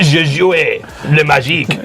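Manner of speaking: halting amateur French, put-on accent. Admitting little French to friends, put-on French accent. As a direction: play the comic Frenchman in French. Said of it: "Still French".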